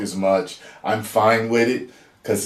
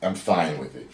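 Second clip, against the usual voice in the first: softer, lower echo to himself; quieter repeat; 7 semitones lower, 7 dB quieter than usual. Real, not acted. Fan reassuring himself, repeating it softer. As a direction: say it again under your breath.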